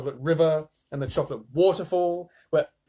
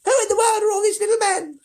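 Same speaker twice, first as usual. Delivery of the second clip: put-on high, squeaky old woman's voice, louder; a stunned old granny pipes up high; Grandma Georgina; 8 dB louder than usual. A narrator's reading. Stunned grandmother asking about the little men, high and squeaky. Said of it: "she said".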